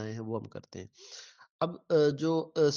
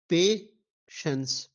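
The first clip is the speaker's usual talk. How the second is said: very slow, a touch louder; split into syllables; 3 semitones higher, 4 dB louder, much slower than usual. Shown as a demonstration. Teacher spelling out syllables for students, slowly. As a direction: say patience slowly as pe-shens, two clear beats. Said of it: "तो इसके तीन हैं".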